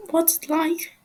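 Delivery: lightly innocent. Acted. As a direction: ask it like a curious child. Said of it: "his voice oddly innocent".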